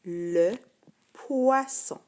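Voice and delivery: "poisson" lifted high and loud with a sharp double S; teacher modelling the word; careful reading. Shown as a demonstration. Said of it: "on a le son S".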